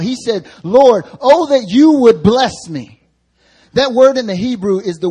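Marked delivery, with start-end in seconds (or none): 0.6-3.0 loud, slow pleading cry; crying out to God. Acted. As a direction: cry it out to the Lord, slowly and loudly. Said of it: "He had to cry out".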